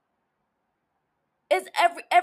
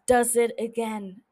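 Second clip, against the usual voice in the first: ordinary talk, nothing marked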